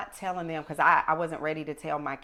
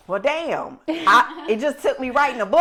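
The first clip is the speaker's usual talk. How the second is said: loud, high, laughing exclamation; laughing punchline; 8 semitones higher, 12 dB louder than usual. Retold or imitated. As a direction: blurt the punchline as laughter takes over. Retold punchline, breaking into laughter.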